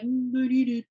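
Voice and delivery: a hint of a begging dog. Owner voicing her begging dog, lightly whiny. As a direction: lightly voice the pup whining for scraps. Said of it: "he comes in like".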